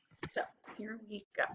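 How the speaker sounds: quick whispered aside. Real, not acted. Teacher whispering to herself while fixing her screen. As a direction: breathe it under your breath to yourself.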